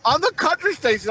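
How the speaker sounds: ordinary talk, nothing marked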